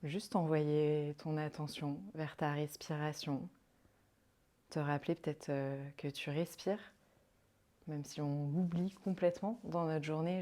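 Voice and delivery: calm, unhurried meditation voice with long pauses; guided meditation. Acted. Yoga guide leading a listener into rest and counted breathing, guided meditation. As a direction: guide them softly into rest, leaving space between phrases.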